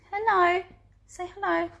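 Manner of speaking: high cooing hello; high-pitched doggy talk. Real, not acted. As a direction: greet the dog in a high, sing-song pet voice. Greeting her dog.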